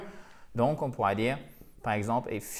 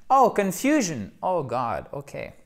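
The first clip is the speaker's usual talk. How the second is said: higher "oh" of sudden discovery, then dropping; mock realisation; 3 semitones higher, slower than usual. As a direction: act out suddenly recognising the word. Acted pupil discovering the word. (fausse révélation, d'abord plus aigu)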